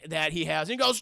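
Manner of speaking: ordinary talk, nothing marked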